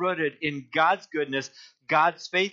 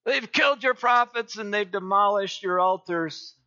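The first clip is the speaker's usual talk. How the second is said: Elijah repeating his complaint; 5 semitones higher than usual. Acted. Elijah answering God again, pleading, fading. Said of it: "he repeats himself".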